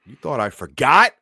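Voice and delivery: hammed-up monster cry; loud taunt. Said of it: "throws open the door".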